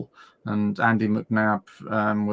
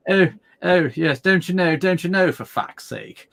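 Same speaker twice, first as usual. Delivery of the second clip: put-on higher-pitched posh English accent; posh English swearing; 7 semitones higher, 4 dB louder than usual. Showing American friends how posh people swear, posh accent. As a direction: swear poshly, all upper-crust English. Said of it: "they swear poshly".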